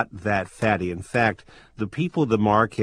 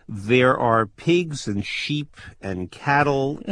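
usual speaking voice

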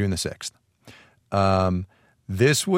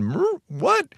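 confused mock what. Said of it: "Chris Pine is standing there going".

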